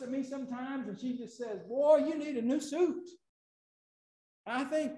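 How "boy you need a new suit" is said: mock motherly scold, louder and higher; a hint of a scolding Southern mama; mother's scolding. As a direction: play mama telling her son off. Mother's repeated line quoted.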